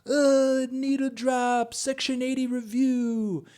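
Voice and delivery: high, slow, stretched call from down the alley. Retold or imitated. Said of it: "I just hear".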